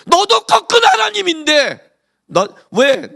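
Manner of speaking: loud, high, slow accusing preach; full-force preaching. Acted. Preacher confronting the congregation, loud slow accusation. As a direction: hammer the question at them.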